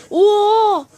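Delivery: sudden loud high oh of surprise; surprised oh. Real, not acted. Gamer seeing a villager glitch into a block, loud surprised oh. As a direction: react like the game just broke.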